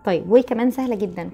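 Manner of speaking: normal talking voice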